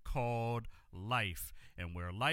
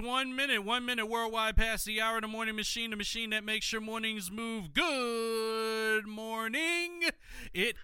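radio announcer; 9 semitones higher, 3 dB louder than usual. Acted. Radio show intro jingle voice.

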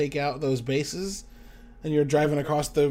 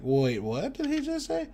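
high, softer puzzled double-take; imitating a confused viewer; baffled question; 9 semitones higher, 5 dB quieter than usual. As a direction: pitch it up in mild bewilderment. Imitates puzzled viewers without subtitles.

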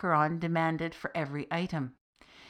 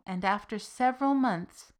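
usual speaking voice

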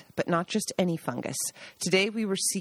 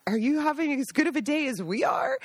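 much higher, bright chatty put-on voice; husband chatting up silent mushroom hunters in a much higher put-on voice; chipper greeting; 6 semitones higher than usual. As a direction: act too friendly with wary strangers. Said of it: "and he'd say".

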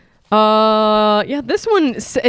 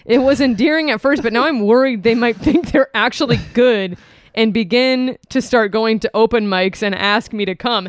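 ordinary talk

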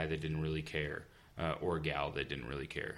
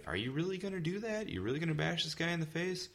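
much higher, disbelieving question; incredulous questioning; 12 semitones higher than usual. Retold or imitated. Game master challenges a player's rash plan, incredulous. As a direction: imitate a doubting game master, pitched way up.

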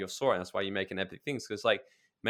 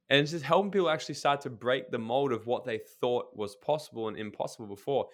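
ordinary delivery

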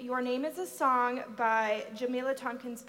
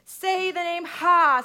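from the chest; 6 semitones higher, 10 dB louder than usual. Acted. Mama demonstrates name from chest.

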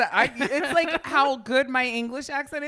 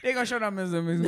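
very fast, slurred Cajun auctioneer; 3 semitones lower, much faster than usual. Pretend. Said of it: "Cajun auctioneer".

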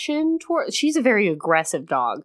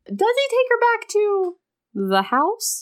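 high, rising fake gasp of scandal; mock-shocked question; 13 semitones higher than usual. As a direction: ham up the scandalized reader.

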